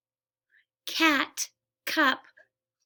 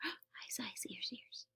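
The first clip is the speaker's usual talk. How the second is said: whispering; 21 dB quieter, slower than usual. Acted. Whispered sound-game chant.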